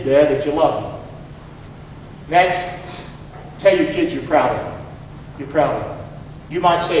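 normal talking voice

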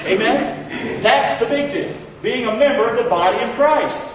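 ordinary talk

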